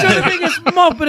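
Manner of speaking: very high comic taunt; mock taunting retort. Arguing back at the guard, mock taunting. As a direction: throw the insult back in a squeaky pitch.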